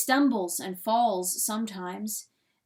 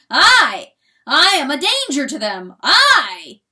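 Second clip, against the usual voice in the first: louder, higher, swelling with pride; triumphant boast; 4 semitones higher, 11 dB louder than usual. A narrator's reading. Rooster boasting his might to hen, loud triumph. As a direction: thunder his own power in triumph.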